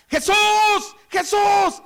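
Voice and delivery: very high, loud drawn-out yells; shouting. Acted. Blind beggar calling to Jesus, high drawn-out shout. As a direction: cry out at the top of your voice. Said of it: "¿Qué es dar voces en la Biblia? Gritar".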